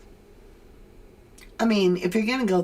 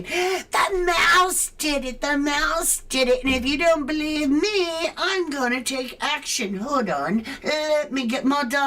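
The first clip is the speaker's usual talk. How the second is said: mocking toddler tantrum; 7 semitones higher than usual. Mocking a searcher who screams at staff, childish whine. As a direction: whine it like a brat stamping his feet. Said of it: "acting like a two-year-old throwing a tantrum".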